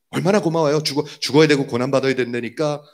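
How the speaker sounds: usual speaking voice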